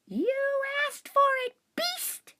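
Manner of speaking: a tiny hamster's battle cry; squeaky hamster voice. Acted. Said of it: "I squeaked".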